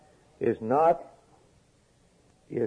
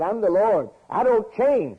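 much higher and louder, ringing proclamation; God's declaration; 10 semitones higher, 5 dB louder than usual. Acted. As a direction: declare it as the Lord.

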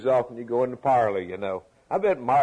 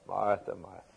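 soft and low, tender; name her gently, like a caress; lovingly; 5 semitones lower, 9 dB quieter than usual. Shown as a demonstration. Jesus lovingly calling Martha, soft and gentle. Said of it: "That's a loving comment".

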